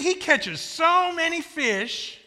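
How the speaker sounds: high, loud, slow "so many fish"; hammed-up amazement on "so many fish"; stress on SO. Shown as a demonstration. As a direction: hold SO up high and long. Repeats punchline to rouse crowd.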